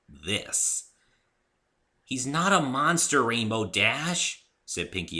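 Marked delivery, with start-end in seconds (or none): 2.0-4.4 a touch of exasperation, louder and higher; lightly exasperated correction. Acted. Pinkie corrects Rainbow Dash, mildly exasperated.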